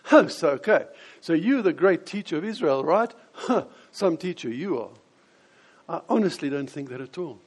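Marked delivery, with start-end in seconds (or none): 0.0-4.9 scoffing "huh", loud at first; mock him as a so-called great teacher; dismissive mockery. Preacher parodying a dismissive reading of Jesus, sarcastic and scoffing. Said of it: "almost as if Jesus is saying".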